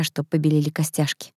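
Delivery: plain reading voice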